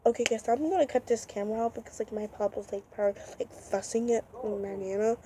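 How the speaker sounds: quickly dropping to a hush. Real, not acted. Vlogger hiding from her fussing pop, hushed and hurried. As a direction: keep it down so pop can't hear.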